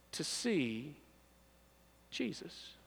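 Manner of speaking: soft and slow, letting the name land; hushed reveal. Acted. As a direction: drop to a near whisper on Jesus. Dramatic pause, hushed reveal.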